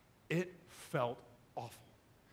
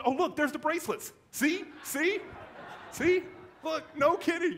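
excited; 11 semitones higher, 5 dB louder than usual. Real, not acted. Pointing excitedly at bracelets.

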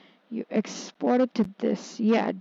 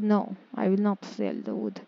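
normal talking voice

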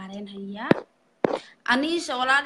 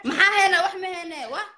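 shouting; 8 semitones higher, 9 dB louder than usual. Real, not acted. Host shouts, challenging the caller.

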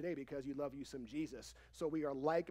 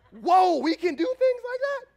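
very loud, high-pitched astonishment; hammed-up, shouted disbelief; mock amazement; 13 semitones higher, 15 dB louder than usual. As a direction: act stunned by an obvious idea. Feigns amazement at meeting in person.

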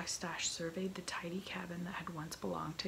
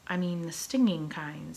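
eager first mate, louder; cheerfully bright; 10 dB louder than usual. A narrator's reading. First mate eagerly explaining his jellyfish idea to the captain, bright. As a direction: chirp up keenly as the hapless mate. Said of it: "Smee continued brightly".

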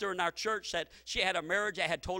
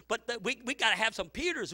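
normal talking voice

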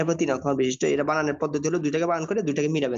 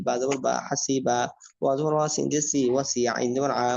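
ordinary talk

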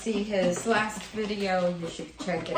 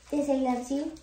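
cooing pet talk; 8 semitones higher than usual. Real, not acted. Baby talk to dog.

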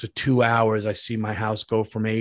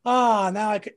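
high relieved ah; imagined relief; 8 semitones higher than usual. Pretend. Man imagining home comfort after camping, high blissful sigh. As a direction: melt into the cool air.

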